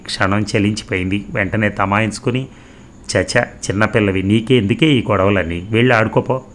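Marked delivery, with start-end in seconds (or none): none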